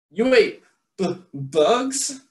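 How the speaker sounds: high stammering disbelief on b- bugs; stuttering shock. Acted. One bat gasps at Stellaluna's diet, stuttering. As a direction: trip over the word in surprise. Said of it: "stuttered one".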